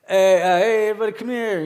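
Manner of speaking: loud call to a crowd on a court. Retold or imitated. Missionary calling the basketball players over, loud. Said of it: "I'm like".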